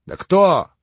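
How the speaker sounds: резкий, громкий крик, всё выше; рявкни почти в ярости; почти свирепо. A narrator's reading. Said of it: "уже почти свирепо вскричал Иван". (почти свирепый крик)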